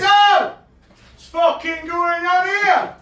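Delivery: retold shout. Coming round the corner to the brick-throwing lads, yelling. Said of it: "I'm like this".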